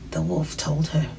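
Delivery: usual speaking voice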